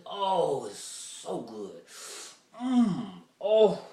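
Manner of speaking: loud, high moans of delight; savoring delight. Retold or imitated. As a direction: savor it like it's delicious. Savoring imagined macaroni.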